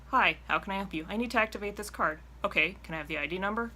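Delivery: fast, no-nonsense model exchange; brisk efficient call. Shown as a demonstration. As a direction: show how quick it should be.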